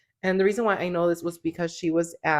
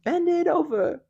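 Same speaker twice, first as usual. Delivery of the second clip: high, drawn-out lyric quote; quoting rapper's lyrics; 12 semitones higher, slower than usual. Retold or imitated. Host quoting the rapper's funny lyrics, high imitation. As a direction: pitch it way up and stretch it out. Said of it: "But she's like".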